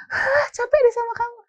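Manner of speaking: high, fed-up sigh of a parent; exasperated parent. Acted. Worn-out papa to a defiant child, exasperated.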